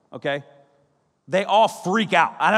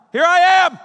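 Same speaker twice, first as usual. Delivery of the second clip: higher, much louder; loud proclamation; 8 semitones higher, 8 dB louder, faster than usual. Retold or imitated. Jesus loudly announcing himself after warning. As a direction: ring it out like a herald. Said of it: "he's like".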